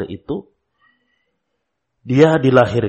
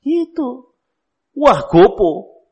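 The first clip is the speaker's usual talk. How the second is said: mock exclaim; 11 semitones higher, 4 dB louder, much slower than usual. Reacting to crying child.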